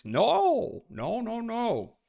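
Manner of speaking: high, indignant no; mock outrage. Real, not acted. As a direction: protest in a squeak.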